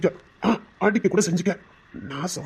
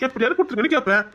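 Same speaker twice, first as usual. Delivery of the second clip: ordinary delivery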